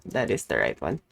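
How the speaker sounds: drops low to answer herself; low, settled reply; low self-reassurance. Pretend. Answers her own question.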